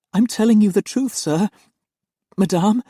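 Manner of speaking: high, loud earnest plea; pleading young nanny. Acted. Nanny insists on the truth to her employers, high and pleading. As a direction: beg them to believe you.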